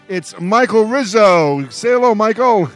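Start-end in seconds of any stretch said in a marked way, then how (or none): none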